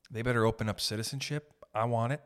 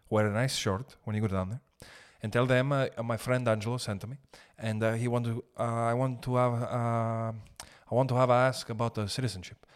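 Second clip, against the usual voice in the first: a hint of a broken-English Italian buddy; hamming up an Italian accent, lightly; buddy's Italian accent. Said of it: "He goes like this".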